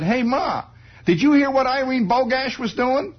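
put-on high, eager boy's voice; innocent kid. Boy eagerly asking his mother about gossip. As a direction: ask it brightly like a small boy.

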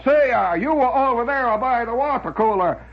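high-pitched fake Italian accent; phony Italian accent. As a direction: ham up a bad Italian accent like the office joker. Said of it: "this guy would say that every five minutes".

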